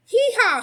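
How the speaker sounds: high, loud mocking cackle; greedy neighbor's loud jeering cackle; cackling hee haw. A narrator's reading. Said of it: "he cackled".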